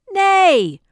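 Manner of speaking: the guests yelling Nay at the moose; yelled. Acted. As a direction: bellow it together. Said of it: "they all yelled".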